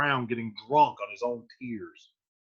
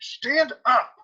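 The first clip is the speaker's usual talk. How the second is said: high, loud acted command; urgent order; 10 semitones higher, 5 dB louder than usual. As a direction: play an exasperated order to get up. Retold friar orders Romeo to stand.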